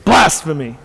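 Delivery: drawn-out mock outcry, then a punchier repeat; measured, acted cry of outrage; retold rage. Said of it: "with rage spoke out".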